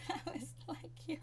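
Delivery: high giggle into a hushed "yo"; laugh, then gasp out "yo"; laughing disbelief. Real, not acted. Reacting to the two-dollar insult, laughing.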